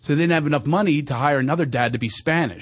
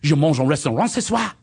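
French accent; 8 semitones higher than usual. Shown as a demonstration. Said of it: "I'll nail it".

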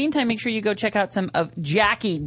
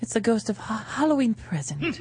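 ghost voice. Acted.

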